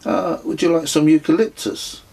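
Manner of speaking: teasing a bemused stranger, high and mock polite; character voice. A narrator's reading. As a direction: offer it with playful mischief. Narrator teases bemused guest.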